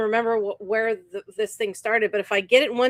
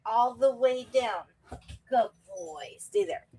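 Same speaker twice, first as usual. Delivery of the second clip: soft, slowing dog commands; hushed, unhurried praise and commands to a pet; talking to dog; 6 dB quieter than usual. Real, not acted. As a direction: coax the dog down quietly. Coaxing own dog to get down.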